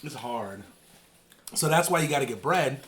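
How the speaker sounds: normal talking voice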